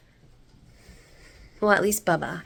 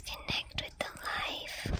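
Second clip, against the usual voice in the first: breathy whispered urging; 17 dB quieter, slower than usual. Real, not acted. Vlogger urging viewers to connect with plants, whispering. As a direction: whisper it like a secret to the garden. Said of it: "connect with the flowers".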